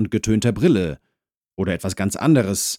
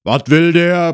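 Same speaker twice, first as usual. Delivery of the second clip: loud, raised grumble from indoors; grumbled; 11 semitones higher, 9 dB louder than usual. Acted. Husband inside asks about the visitor, grumbling loudly. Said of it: "brummte der Mann von drinnen".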